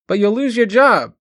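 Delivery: a mother's alarmed cry, high and loud. A narrator's reading. Mother crying out to son refusing work, alarmed. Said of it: "she cried".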